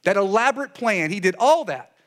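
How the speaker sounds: high, loud, emphatic disbelief; mock incredulous. Preacher voices the people accusing God, high and incredulous. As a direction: pile it on in worked-up sarcasm.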